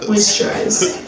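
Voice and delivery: ordinary talk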